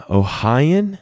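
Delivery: Ohioan? lifted high with a rising question. Shown as a demonstration. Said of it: "no, higher".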